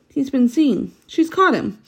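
high, anxious rush of fear; a touch of panic in Lucy's thought; Lucy fearing Mister Beaver is caught, frightened. Acted.

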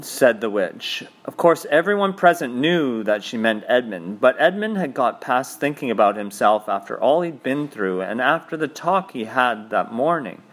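ordinary delivery

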